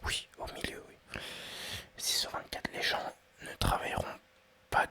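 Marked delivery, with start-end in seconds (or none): none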